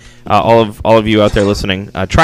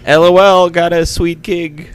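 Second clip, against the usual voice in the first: mock text-speak; 6 semitones higher than usual. Text speak read aloud.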